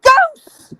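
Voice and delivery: very high, loud mock-terrified squeal; panicked gasp. Reaching dad out of breath, high terrified cry. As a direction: squeal it out, winded and scared.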